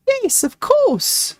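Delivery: high, drawn-out surprised yes; surprised agreement. Acted. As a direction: stretch it out high, taken aback. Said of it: "giving his aunt a surprised look".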